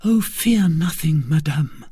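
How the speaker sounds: calm her fears; reassuring young woman. A narrator's reading. Maid comforting the frightened queen, earnest reassurance.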